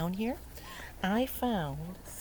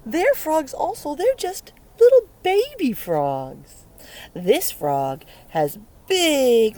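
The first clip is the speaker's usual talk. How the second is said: excited; 5 semitones higher, 10 dB louder than usual. Real, not acted. Excited about baby frogs.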